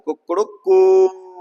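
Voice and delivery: a teacher mimicking a rooster's cock-a-doodle-doo; rooster crow imitation. Shown as a demonstration. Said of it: "मुर्गे की ध्वनि कुछ इस प्रकार से होती है".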